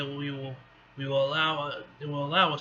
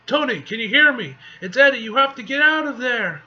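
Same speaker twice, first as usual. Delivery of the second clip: loud, higher call to a teammate; urgent warning shout; 8 semitones higher, 11 dB louder than usual. Acted. Eddie tells Tony to get out, urgent shout. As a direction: shout for him to get clear.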